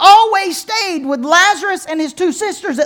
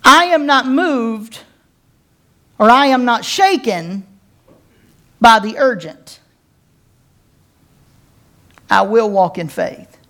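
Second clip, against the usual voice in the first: slow, firm, every word weighed; slow declaration; 5 semitones lower than usual. Shown as a demonstration. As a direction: declare it slowly, planting each word. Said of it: "I want us to begin to say".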